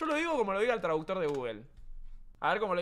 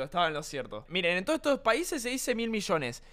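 usual speaking voice

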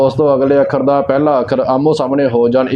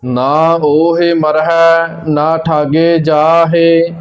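slow, measured recitation on a level tone; scripture recitation; much slower than usual. Acted. Teacher reciting Gurbani for learners, slow measured recitation. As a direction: recite line by line, drawing out the vowels.

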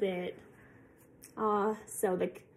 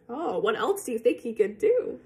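usual speaking voice

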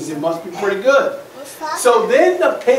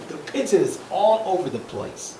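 plain reading voice

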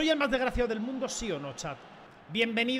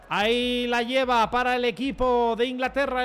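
loud, drawn-out commentator call; mock commentator; 3 semitones higher, 7 dB louder than usual. Gamer replaying his goal as a football commentator. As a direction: call it like a radio play-by-play.

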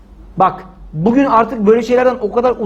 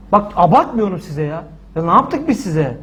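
usual speaking voice

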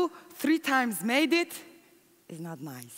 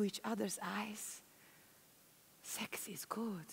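whisper; 2 semitones lower, 14 dB quieter than usual. Real, not acted. Tender intimate memory, whispered.

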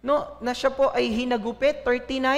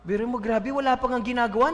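usual speaking voice

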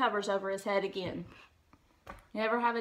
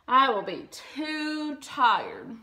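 tired; 3 semitones higher, 6 dB louder, much slower than usual. A narrator's reading. Toad refuses, sleepy.

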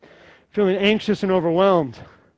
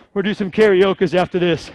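ordinary delivery

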